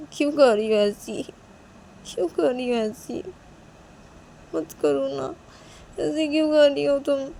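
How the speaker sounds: high, whiny plea on the verge of tears; tearful Manisha. A narrator's reading. Girl begging her friend to stop, tearful whine. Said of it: "तो मनीषा ये बोल के रो देती है".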